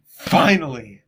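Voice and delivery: relieved cry. Real, not acted. Relieved the story is over.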